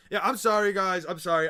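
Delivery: loud, high burst of sorry; over-the-top public apology; exaggerated apology. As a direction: apologize loudly to the chat, hammed-up. Mock apology to the chat.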